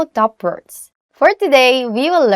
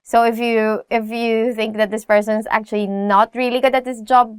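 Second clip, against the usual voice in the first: normal talking voice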